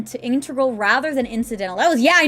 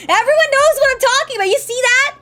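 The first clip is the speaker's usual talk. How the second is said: high, loud, insistent protest; worked-up, high-pitched rant; mock-indignant outburst; 12 semitones higher, 6 dB louder than usual. Real, not acted. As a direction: defend yourself at the top of your voice. Playful indignant defence of own wording.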